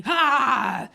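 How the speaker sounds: single booming "Ha!"; imitated neighbour's blaring laugh; loud mock laugh. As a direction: bark one very loud, high fake laugh. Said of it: "he'd laugh really loudly".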